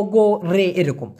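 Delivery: ordinary talk, nothing marked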